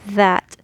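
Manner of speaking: that with a voiced th buzz; tongue out for that; voiced th demo. Shown as a demonstration. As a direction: that said slowly with a voiced th. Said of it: "when we say that".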